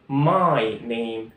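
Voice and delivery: My name is Jack drawn out very slowly; teacher modelling the answer; slow, clear classroom drill; slow model answer. Shown as a demonstration. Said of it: "الجواب بيكون".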